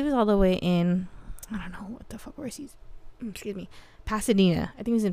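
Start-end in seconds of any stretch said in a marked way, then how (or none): 1.5-3.7 whispered aside. Real, not acted. Whispered aside while recalling.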